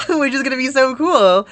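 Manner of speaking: laughing, high and loud; real giddy excitement with a laugh; excited. Real, not acted. Telling viewers about starting teaching, excited.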